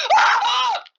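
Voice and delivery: very high, loud shriek; startled scream. Real, not acted. Gamer screaming when startled. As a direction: scream in fright.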